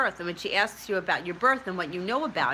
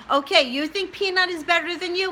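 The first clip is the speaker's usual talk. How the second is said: challenging; 10 semitones higher, 4 dB louder than usual. Real, not acted. Mock challenge to herself.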